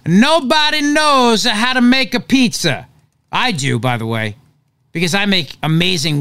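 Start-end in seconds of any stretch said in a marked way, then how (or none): 0.0-2.9 high, slow mock-Italian accent; hammed-up Italian accent, pitched high. Shown as a demonstration. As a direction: proclaim it in a drawn-out stage Italian accent. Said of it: "gotta say it in an Italian accent".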